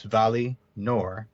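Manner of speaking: "Vali Nor" said very slowly as two separate words; split into two roots. Shown as a demonstration. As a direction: pull apart "Vali" and "Nor" with a clear break. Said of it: "two separate roots".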